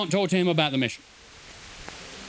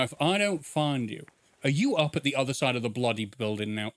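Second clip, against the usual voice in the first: normal talking voice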